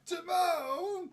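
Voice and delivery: high, booming cartoon warthog shout; loud, hammed-up animal character bellow; Pumbaa calling Timon. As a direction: holler urgently in a silly cartoon voice, laughing at the end.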